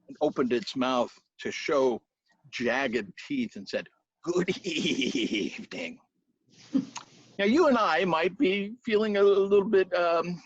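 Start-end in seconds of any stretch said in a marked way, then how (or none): 4.2-6.0 monster voice. Acted. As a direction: lean in and draw it out like a beast. Monstrous Pooka greets the hero.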